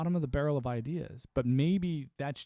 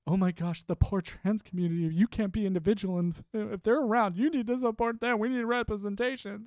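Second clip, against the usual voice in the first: higher, lightly mocking whine; mocking activist voice; 6 semitones higher than usual. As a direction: parody them in a slightly higher voice. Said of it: "at the expense of".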